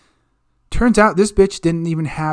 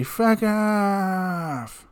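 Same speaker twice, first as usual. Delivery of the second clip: dismissive; 6 semitones higher than usual. Pretend. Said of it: "and say".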